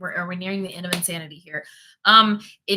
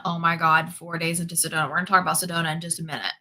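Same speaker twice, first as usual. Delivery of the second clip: normal talking voice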